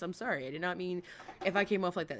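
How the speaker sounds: ordinary delivery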